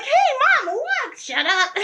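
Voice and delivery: high, gleeful, then a mock snap; teasing mom. Daughter replays the tease and mom's reply, playful. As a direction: tease bright, answer clipped.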